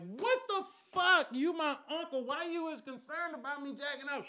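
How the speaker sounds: high, loud incredulous protest; outraged disbelief. Acted. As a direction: cut him off, voice shooting way up. Interrupts uncle in outraged disbelief.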